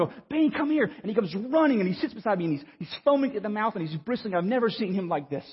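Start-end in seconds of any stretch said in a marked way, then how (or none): 0.3-0.8 higher-pitched mock yell to a dog; retold yell. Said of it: "I yell for him to come".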